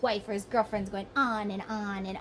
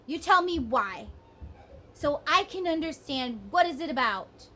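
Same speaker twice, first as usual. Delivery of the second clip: normal talking voice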